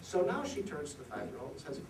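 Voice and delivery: ordinary delivery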